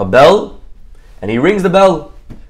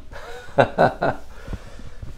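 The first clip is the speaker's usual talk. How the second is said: soft, breath-filled run of chuckles; acted mocking laugh; 9 dB quieter than usual. Rich man laughs at the fool, mocking. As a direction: laugh at him, a performed chuckle. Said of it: "The man starts laughing".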